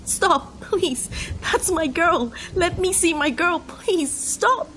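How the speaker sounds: very high, desperate cries; inconsolable pleading. A narrator's reading. Man dragged from his girl's body, inconsolable. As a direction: beg and scream, voice way up. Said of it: "I was inconsolable".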